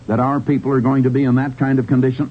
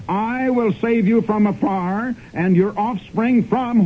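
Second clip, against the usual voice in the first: slow, raised solemn reading of scripture; 6 semitones higher, slower than usual. Acted. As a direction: intone each word like holy writ.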